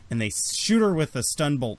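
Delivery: ordinary talk, nothing marked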